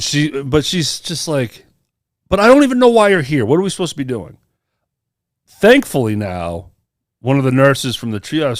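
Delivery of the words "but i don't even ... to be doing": high, loud, rushed protest, then easing off; exasperated clerk. Retold or imitated. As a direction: imitate an exasperated, clueless receptionist. Retold clerk arguing with the patient.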